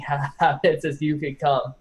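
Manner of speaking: usual speaking voice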